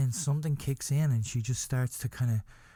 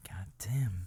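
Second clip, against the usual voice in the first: low whispered curse of pleasure; whispered, mock-pleasured mutter; hushed aside; 6 semitones lower, 6 dB quieter than usual. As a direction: drop from wailing to a breathy whisper. Acted pleasure amid mock grief.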